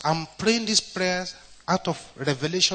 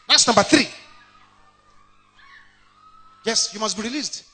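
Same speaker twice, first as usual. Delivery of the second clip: preaching at full force; 10 dB louder than usual. Real, not acted. Calling out deliverance.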